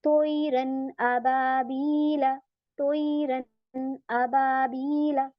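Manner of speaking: slow, drawn-out tajweed recitation, no nasal hum; clear recitation. Shown as a demonstration. Teacher demonstrating izhar to students, slow and clear. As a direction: recite तोईरन अबाबीला slowly, with no ghunna on the noon. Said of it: "आप इसको ऐसे ही पढ़ेंगे".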